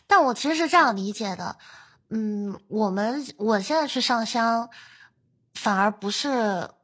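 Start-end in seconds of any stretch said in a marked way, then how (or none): none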